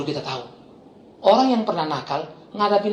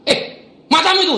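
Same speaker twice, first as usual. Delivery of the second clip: high, loud acted snap; barked rebuke; 11 semitones higher, 5 dB louder than usual. Pastor rebuking a thug, acted bark. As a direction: cut him off hard. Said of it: "Langsung saya bentak".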